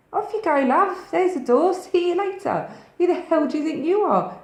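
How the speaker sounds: acted high, loud dismissal; loud, high, scornful mock telling-off; indignant send-off. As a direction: play showing a partner the door. Acts out dismissing an abusive partner.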